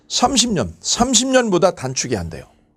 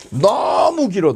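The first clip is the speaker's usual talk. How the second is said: "너무" stretched long and higher; drawn-out emphasis; 4 semitones higher, much slower than usual. Real, not acted. Teacher tells class how long the term is, drawn-out emphasis. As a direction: draw out "너무" to stress the length.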